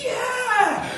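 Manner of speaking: high, laughing whoop of joy; a heart jumping with happiness; joyful whoop. Acted. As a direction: leap with delight. Jim's heart leaps.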